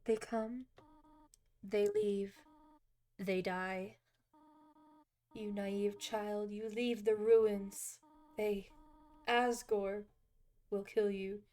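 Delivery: soft, measured character reading; motherly game character. Acted. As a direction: read it gently, like a grave guardian. Reads game character's dialogue aloud.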